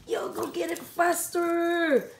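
loud, slow call to the pet. Real, not acted. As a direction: hurry the pet along, loud and drawn out. Urging pet to fetch.

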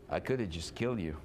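abrupt drop to a low, quick threat; sudden cold threat. Acted. Laban turns on Jacob in the next sentence, sudden threat. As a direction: switch instantly from weepy to menacing, voice dropped. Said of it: "goes from sweet and crying to like".

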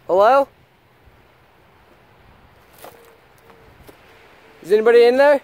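loud, high call into the house. Real, not acted. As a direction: shout it into the dark building. Calling into empty house.

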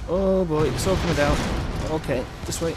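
loud panicked shouting; being chased. Real, not acted. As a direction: yell in panic as it collapses. Everything collapsing around player.

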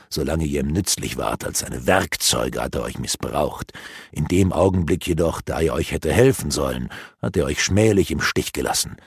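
dark elf mocking the captured apprentices; gloating dark elf. A narrator's reading. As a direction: taunt them with malice.

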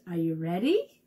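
normal talking voice

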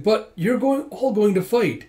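high-pitched, pleading objection; worried protest. Acted. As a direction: object anxiously, voice pitched right up. Worried protest at being excluded.

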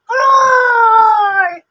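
a big loud troll roar from under the bridge. Acted. Troll bursting out at the goats, loud roar.

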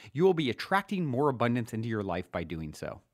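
normal talking voice

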